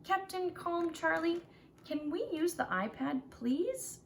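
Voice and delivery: put-on high, begging child voice; a kid asking please; Carlos pleading. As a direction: plead sweetly with the captain. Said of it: "Carlos says".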